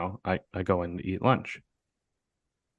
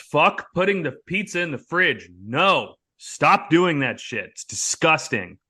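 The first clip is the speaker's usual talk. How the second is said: loud, high rant; ranting about putting pizza in the fridge; mock outrage; 7 semitones higher, 4 dB louder, slower than usual. Real, not acted. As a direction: rail against fridge pizza.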